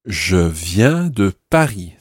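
slow, evenly spaced French phrase; slow model pronunciation. Shown as a demonstration. Teacher repeats the learner's answer, slow model pronunciation. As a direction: enunciate each word slowly for a learner.

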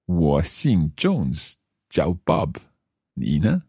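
slow, careful learner Mandarin; careful Chinese accent. Acted. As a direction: speak each syllable slowly, role-playing. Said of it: "my best Chinese accent".

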